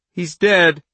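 put-on high, loud girl's voice cutting in; child's blunt announcement. Charlie tells the couple her father is dead, high and loud. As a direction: say it bluntly from the doorway. Girl interrupts from doorway.

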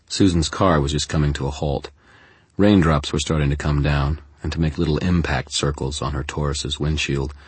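plain reading voice

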